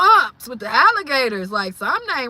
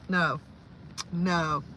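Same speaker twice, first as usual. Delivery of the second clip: ordinary talk, nothing marked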